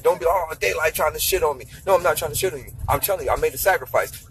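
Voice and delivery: usual speaking voice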